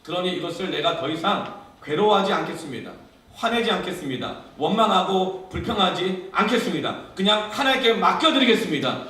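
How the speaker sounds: loud, high declaration, steadily building; full-force preached prayer. Acted. Believer resolving to entrust it all to God, full-force declaration. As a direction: build the vow into a ringing cry.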